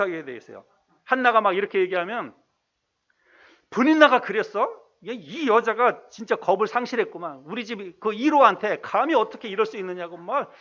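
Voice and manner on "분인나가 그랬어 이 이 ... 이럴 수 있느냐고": acted flare-up, higher and louder; mock husband's outrage. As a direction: play a husband indignant on his wife's behalf. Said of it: "막 화를 버럭버럭 내면서". (아내 편에서 버럭 화내는 남편 흉내)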